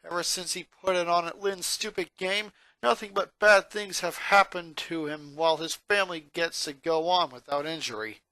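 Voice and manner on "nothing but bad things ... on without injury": plain reading voice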